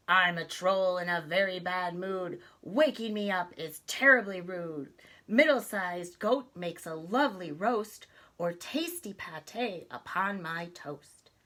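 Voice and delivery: grumpy troll. Acted. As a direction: bellow the rhyme like a sore-headed troll. Troll threatens middle goat.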